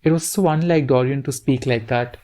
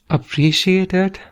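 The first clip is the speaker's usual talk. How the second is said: incredulous; 5 semitones higher than usual. A narrator's reading. Dorian incredulous.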